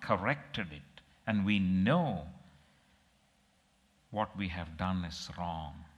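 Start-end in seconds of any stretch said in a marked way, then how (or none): none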